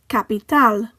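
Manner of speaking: Capital with a stressed final TAL; final-syllable stress. Shown as a demonstration. Teacher contrasting it with English, Capital stressed on TAL. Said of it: "stress falls on the last syllable".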